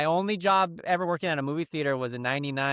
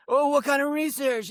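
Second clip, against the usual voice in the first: pushy salesman; 12 semitones higher, 3 dB louder than usual. Retold or imitated. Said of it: "he was very pushy".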